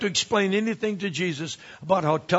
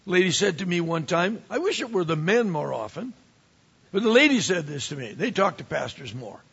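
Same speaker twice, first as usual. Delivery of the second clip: ordinary talk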